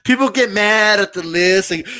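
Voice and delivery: ordinary talk, nothing marked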